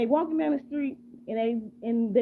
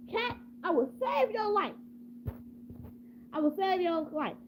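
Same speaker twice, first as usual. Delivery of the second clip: lightly voiced rescuer, pitched up at first; heroic rescuer; 5 semitones higher, 4 dB quieter than usual. A passerby promises to rescue the cornered cat, lightly heroic. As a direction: announce the rescue like a story hero.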